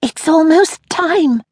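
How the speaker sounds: high, slow eager whisper; breathless with anticipation; excited whisper. Acted. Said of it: "whispered excitedly".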